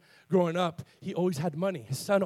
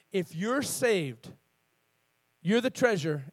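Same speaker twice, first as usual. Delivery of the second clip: slow, deliberate delivery with pauses; very slow reading; slower than usual. Real, not acted. Preacher re-reading a verse to the congregation, very slowly. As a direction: let each word land slowly. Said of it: "read that again very slowly".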